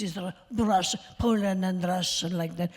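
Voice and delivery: demonstrating a mother's Polish-Russian accent. Shown as a demonstration. Actress demonstrates the mother she played, Polish-Russian accent. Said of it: "this is the way she talked".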